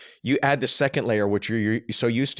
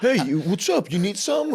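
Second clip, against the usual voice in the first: a hint of a guy hitting on her, pitched up; joking street pickup; 10 semitones higher than usual. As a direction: toss out a higher, joking come-on. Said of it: "every guy in the city's gonna be like".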